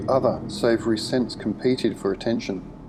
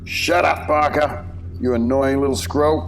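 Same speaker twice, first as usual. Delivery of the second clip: booming, high-pitched angry command; Mister King; 5 semitones higher, 4 dB louder than usual. A narrator's reading. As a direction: boom out a loud rebuke at a prisoner. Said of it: "Mister King's voice boomed out".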